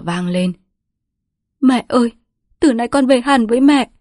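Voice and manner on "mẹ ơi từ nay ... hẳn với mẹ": kêu to, cao, nghẹn nấc; khóc nấc gọi mẹ; nấc lên. A narrator's reading. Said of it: "Tiếng con Nga nấc lên". (con gái nấc lên với mẹ)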